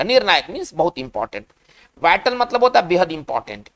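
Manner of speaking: usual speaking voice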